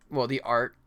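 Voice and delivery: ordinary talk, nothing marked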